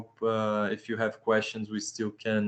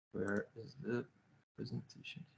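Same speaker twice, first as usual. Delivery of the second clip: half-whispered, much softer question to himself; muttered aside; 16 dB quieter than usual. Real, not acted. Presenter looking for his slides, mutters to himself under his breath. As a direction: mutter it under your breath while searching.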